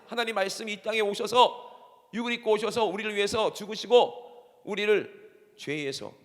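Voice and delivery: ordinary talk, nothing marked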